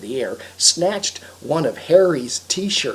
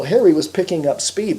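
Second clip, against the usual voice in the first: ordinary delivery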